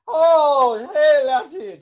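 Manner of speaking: put-on loud, squealing caricature of a Scottish accent; high-pitched mock Scottish. Mocking how people mimic Celtic accents, high-pitched Scottish caricature. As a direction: shout it high and drawn out, cartoon Scot. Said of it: "like, a high pitch".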